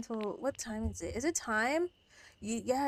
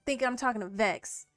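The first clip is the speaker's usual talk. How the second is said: normal talking voice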